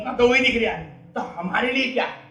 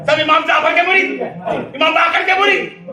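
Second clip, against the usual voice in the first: raised sermon cry, slowing at the end; full-force preaching; 4 semitones higher than usual. Acted. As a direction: proclaim it to the congregation. (پورے جوش سے خطابت)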